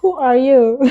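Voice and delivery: usual speaking voice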